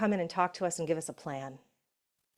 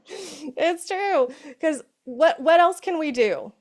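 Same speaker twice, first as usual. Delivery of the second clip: excited; 8 semitones higher, 7 dB louder, slower than usual. Real, not acted. Excited after laughing.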